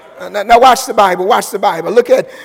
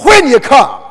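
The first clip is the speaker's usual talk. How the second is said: raised, ringing preacher's call, slowed on the quotes; preaching at full force; 7 semitones higher, 10 dB louder, slower than usual. Acted. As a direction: plead with the congregation to come now.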